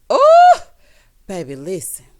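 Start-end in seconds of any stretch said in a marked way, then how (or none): 0.1-0.6 loud, high-pitched "ooh"; scandalized high cry; shocked whoop. Real, not acted. Shocked reaction to the retold retort.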